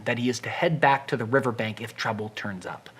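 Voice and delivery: normal talking voice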